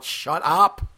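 half-hearted re-enactment of yelling; retold yell. Driver repeating what he yelled in the car, restrained mock yell. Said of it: "I actually yelled".

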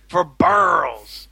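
cartoonish Fat Albert impression of a customer; retelling a pharmacy customer as Fat Albert. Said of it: "adopted Fat Albert's voice".

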